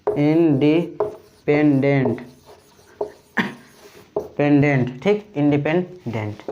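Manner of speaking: "Independent" drawn out syllable by syllable, very slowly; teacher slowly dictating "independent"; slow spelling-out. Shown as a demonstration. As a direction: stretch out "independent" so students catch each part. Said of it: "दूसरे में देखा independent".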